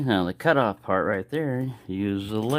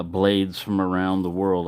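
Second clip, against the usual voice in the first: ordinary talk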